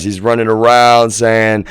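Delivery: usual speaking voice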